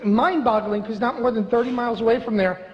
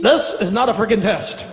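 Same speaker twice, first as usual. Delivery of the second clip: announcer voice. Retold or imitated. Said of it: "hear that tone like".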